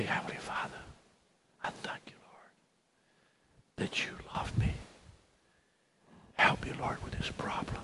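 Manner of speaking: hushed, intimate bedtime prayer; whispered prayer. Acted. Preacher shows how to pray before bed, whispering. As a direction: whisper it softly, with pauses between phrases. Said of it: "You just kneel down before you go to bed".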